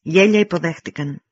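usual speaking voice

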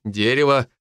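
plain reading voice

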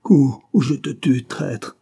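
angry, drawn-out menace; growled threat. A narrator's reading. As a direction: growl it at a traitor. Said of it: "gronda le duc".

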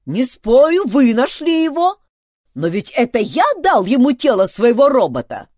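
loud, high-pitched arguing; indignant, emphatic reasoning; insistent. A narrator's reading. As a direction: press your claim heatedly. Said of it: "настаивал Дункан".

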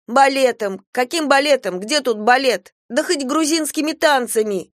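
put-on high, indignant girl; indignant protest. A narrator's reading. Girl protesting to grandmother about ballet, indignant and high. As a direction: scoff at the very idea of ballet.

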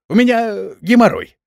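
high, loud hesitant pleading; excuse-making old master. A narrator's reading. Woken master makes panicky excuses. (высоким голосом, оправдываясь)

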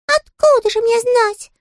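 very high, tiny sulky voice; a small touchy critter, piping high; squeaky little creature. Acted. Щепкин's character voice.

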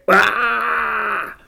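high, drawn-out pained yell. A narrator's reading. Zed yells as burning pain shoots through his arm. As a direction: cry out long and high at searing pain. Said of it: "Zařval jsem".

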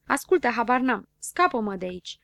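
ordinary delivery

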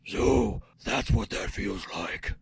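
whispery, very low and slow; Batman. Acted. Hero alone on the roof, low hoarse whisper. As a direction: rasp it low like a brooding vigilante. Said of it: "do it still in the Batman voice".

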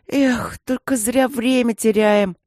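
высоко и громко, протяжная досада; посетуй медленно, на повышенном тоне; сокрушаясь. A narrator's reading. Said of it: "сокрушался Женька". (наигранное мальчишеское сокрушение)

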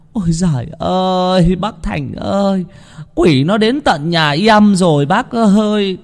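high, tearful wailing woman; whimpering lament. A narrator's reading. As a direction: wail to the chief, near tears. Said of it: "Bà Mai mếu máo". (bà Mai mếu máo, giọng cao, gần khóc)

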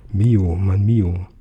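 very slow, lingering on Mio; a father's tender murmur at night. A narrator's reading. Father lying awake, thinking his son's name: slow, tender.

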